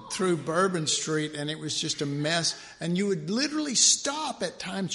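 normal talking voice

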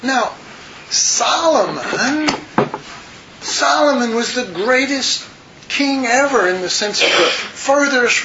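high, marveling preacher's build over Solomon; fervent preaching. Acted. Preacher marveling to his flock at Solomon's glory, high fervent. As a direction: lift the whole room in wonder.

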